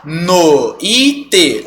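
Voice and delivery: noite as "no... Í... te", slow; three beats in noite, i given full force; forced i. Shown as a demonstration. Teacher showing the absurd result, noite said no... Í... te. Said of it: "senão você teria que pronunciar".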